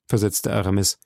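ordinary delivery, nothing marked

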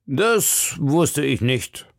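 langsam und milder gesprochen; milder Ton. A narrator's reading. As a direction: nimm den Zorn sanft zurück. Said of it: "in einem etwas milderen Ton". (milder Tréville beschwichtigt die Musketiere)

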